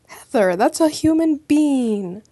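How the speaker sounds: shocked. Real, not acted. Shocked at a corpse.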